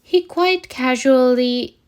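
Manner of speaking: plain reading voice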